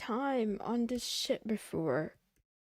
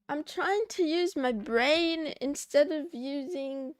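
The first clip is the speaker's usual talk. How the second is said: usual speaking voice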